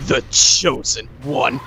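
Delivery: slow, drawn-out sneer at the Chosen One; lightly voiced taunting sorcerer; hammed-up villain voice, slowed right down; mocking villain. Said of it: "he's like".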